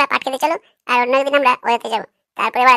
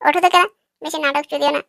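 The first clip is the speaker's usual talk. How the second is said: normal talking voice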